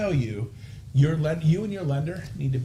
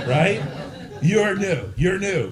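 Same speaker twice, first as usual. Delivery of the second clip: teasing laugh; 5 semitones higher, 4 dB louder, slower than usual. Real, not acted. Teasing the new agents.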